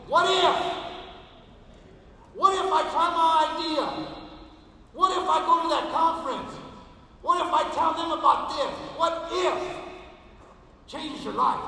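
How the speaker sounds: loud, high rallying cry; ringing what if called to a crowd; proclaiming what if. Shown as a demonstration. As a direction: shout it like a leader daring you to try. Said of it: "I said something every great leader has to say".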